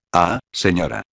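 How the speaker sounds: mild, understated reproach; gently scolding the queen; reproachful. A narrator's reading. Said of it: "con tono de reconvención". (reproche suave y contenido)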